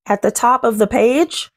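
usual speaking voice

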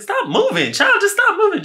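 very high, panicky squeal; frantic pleading. Acted. Storyteller telling the date to freeze at footsteps, frantic squeal.

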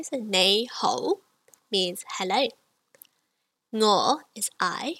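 usual speaking voice

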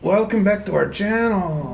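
put-on Spanish accent. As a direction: greet viewers in a mock Spanish accent. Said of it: "allowed to do Spanish accents".

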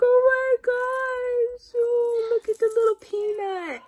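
high, slow, gushing over the ultrasound; mock-gushing sister squealing over the ultrasound. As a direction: coo like the sister seeing her baby. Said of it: "And she's like".

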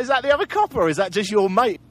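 high, loud mock disbelief; loud, comic incredulity; incredulous retold question. Retells incredulous question to the cop.

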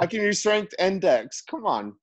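high-pitched insistent plea; protesting. Real, not acted. Player insisting to game master on both stats, protesting. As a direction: push back, pitch up on the claim. Player keeps arguing the ruling.

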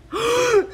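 loud, high gasp; sharp intake of joyful shock; delighted gasp. Real, not acted. Fan finding her guess was right, delighted gasp.

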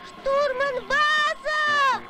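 drawn-out shout of the name; calling out. Acted. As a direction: yell it long and loud to reach him. Calling for help in danger. (протяжный громкий зов)

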